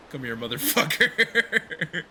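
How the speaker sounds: loud slow laughing taunt; gleeful battle growl. Real, not acted. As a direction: drag it out, laughing at the enemy. Attacking enemy in game.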